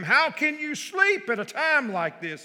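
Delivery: high, loud angry shout of a captain. Acted. Ship captain yelling at sleeping Jonah, loud and high. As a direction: yell him awake in alarm. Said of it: "he shouted".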